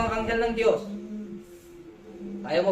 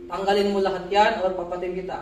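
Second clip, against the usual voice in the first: usual speaking voice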